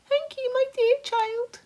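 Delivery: high, loud fussy creature voice; a bossy high-pitched centipede nagging. Acted. Centipede fussing at James over his boots, high and loud.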